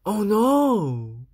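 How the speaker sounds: loud oh no; dismayed cry. Acted. Louis dismayed by fingerprints.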